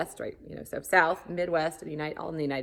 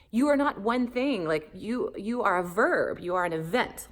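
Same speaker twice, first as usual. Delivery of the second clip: higher, louder, eager quoting of her husband; excited husband; 6 semitones higher, 6 dB louder than usual. Retold or imitated. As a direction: bring out his sudden excitement. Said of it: "got all excited".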